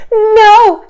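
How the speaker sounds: high, loud gasping no; breathless high gasp. Acted. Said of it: "gasped the rhinoceros".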